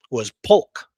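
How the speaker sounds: ordinary delivery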